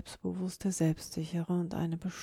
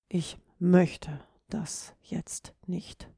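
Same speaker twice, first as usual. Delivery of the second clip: slow, word by word, „möchte“ punched; stress on „möchte“; much slower than usual. Shown as a demonstration. Podcaster shows you don't feel like it, stress on „möchte“. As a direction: lean hard on „möchte“. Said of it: "du hast keine Lust".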